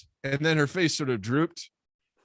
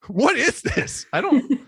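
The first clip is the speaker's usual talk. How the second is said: much higher, louder flustered questions; panicked confusion; 10 semitones higher, 4 dB louder, slower than usual. Retold or imitated. Narrator confused by the woman and Colin, high and frantic. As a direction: fire the questions in a rising panic. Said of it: "I'm like".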